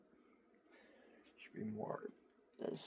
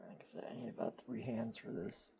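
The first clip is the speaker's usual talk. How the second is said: whispering; 7 dB quieter than usual. Real, not acted. Keeps whispering after ASMR remark.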